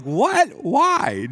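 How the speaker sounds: high, startled what and why; shocked disbelief. Retold or imitated. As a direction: react like it makes no sense at all. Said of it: "We're like".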